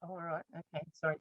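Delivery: very soft, low acted climb-down; sheepish apology. Caught-out parents to Miss Mags, sheepish apology. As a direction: give in meekly, barely voiced.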